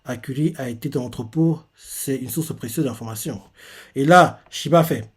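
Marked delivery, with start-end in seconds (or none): 0.0-3.4 softer, lower thinking-aloud voice; inner thought. Retold or imitated. Shiba thinking to himself, softly. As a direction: say it to yourself, under your breath. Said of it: "se dit dans sa tête".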